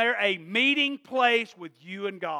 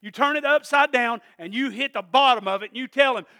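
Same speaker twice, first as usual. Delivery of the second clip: ordinary talk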